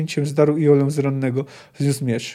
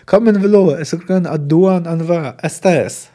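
cried out; 3 semitones higher, 6 dB louder than usual. A narrator's reading. Elf woman shouts on finding the wounded colonel, crying out. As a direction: cry it out urgently, louder at the start. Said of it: "krzyknęła".

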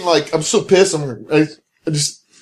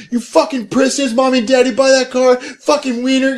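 retold yelling taunt; 7 semitones higher than usual. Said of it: "yelling obscenities at it".

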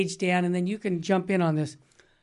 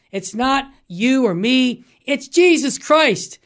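emphatic preaching; 4 semitones higher, 7 dB louder than usual. Real, not acted.

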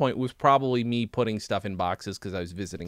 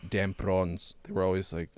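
put-on softly muttered South African accent; 6 semitones lower, 5 dB quieter than usual. Retelling coworkers' secret grumbling, mock South African accent. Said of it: "sort of say in a South African accent".

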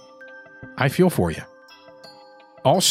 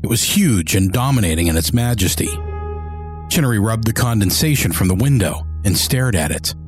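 low, measured audiobook narration; story narration; 3 semitones lower than usual. A narrator's reading.

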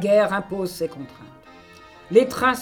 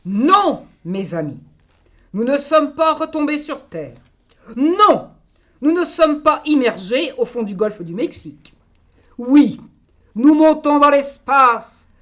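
grand stage orator, lifted and proud; theatrical declamation; 4 semitones higher, 6 dB louder than usual. Acted. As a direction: proclaim it like a nineteenth-century hero. Jules Verne text declaimed.

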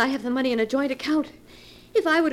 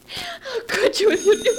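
anguished cry; 9 semitones higher than usual. Acted. Wife's anguish alone.

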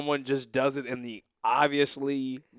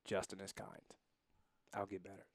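quiet mantra; 6 semitones lower, 15 dB quieter than usual. Shown as a demonstration. Husband softly reciting a patience mantra to his wife, quiet. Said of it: "Love is patient, love is kind".

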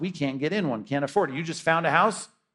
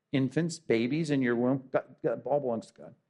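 ordinary talk, nothing marked

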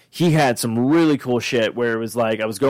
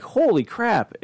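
high-pitched mock "holy crap", jumping up in pitch; retold surprise; 10 semitones higher, much slower than usual. Fan flipping through an artist's prints, retelling the surprise. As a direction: recreate the jolt of spotting something great.

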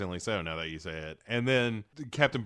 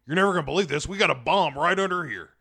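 fast, loud, higher excited soldier; excited Rick Flag; 5 semitones higher, 4 dB louder, faster than usual. Retold or imitated. Podcaster mimicking Rick Flag's eager bomb news, fast and loud. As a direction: blurt it out in a rush, thrilled. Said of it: "Rick Flag is like".

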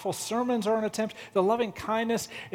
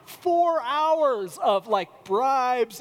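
higher, slow, louder drawn out; real exasperation; exasperated stretch; 5 semitones higher, 3 dB louder, much slower than usual. Real, not acted. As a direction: stretch it in disbelief. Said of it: "exasperated emphasis".

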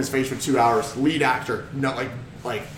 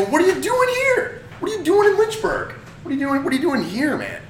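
mock confrontation; 13 semitones higher than usual. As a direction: needle him in a high, disbelieving voice. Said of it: "almost shooting off to him".

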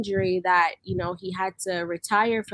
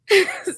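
short high jittery laugh; nervous laugh; 11 semitones higher, 6 dB louder than usual. Real, not acted. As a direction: laugh off the nerves. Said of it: "sorry, I'm nervous".

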